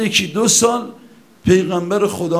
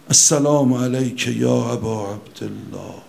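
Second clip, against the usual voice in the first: hushed devotional greeting; 9 semitones lower than usual. Shown as a demonstration. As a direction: say it gently in a moment alone. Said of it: "بگی". (سلامی آرام و عاشقانه با صدای پایین)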